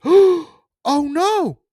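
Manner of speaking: high, loud alarmed cry; high-pitched squeal of dismay; panicked girl. Retold or imitated. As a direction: play her sudden panic big. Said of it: "and says".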